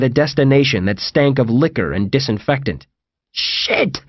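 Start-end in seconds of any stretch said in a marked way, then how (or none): 3.3-4.0 sharp whispered curse; hissed. A narrator's reading. John landing in the wrong place, hissed. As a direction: hiss the swear word under pressure. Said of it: "hissed John".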